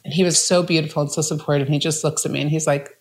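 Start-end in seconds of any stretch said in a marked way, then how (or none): none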